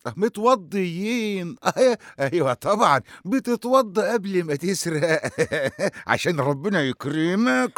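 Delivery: mock them with exaggerated disbelief; sarcastic mockery. Sheikh mocks thieves who did ablutions.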